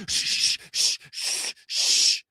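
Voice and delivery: a string of shushes; imitated hush in worship. Worshippers hushing whoever walks in, mock shushing. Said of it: "they're like".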